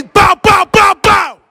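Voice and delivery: high, loud shouted pop pop; shouted ad-lib. Shown as a demonstration. Host shows a passerby the ad-lib, loud shout. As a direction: bark the ad-libs like a drill rapper. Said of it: "Say bow".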